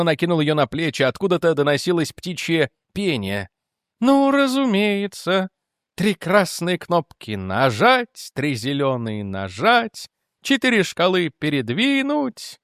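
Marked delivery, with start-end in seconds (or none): 4.0-12.6 slow, drawn-out singsong swinging up high; deranged lieutenant. Acted. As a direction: chant it slowly to yourself, as if entranced. Mad lieutenant muses over the buttons. (медленно, нараспев, как помешанный)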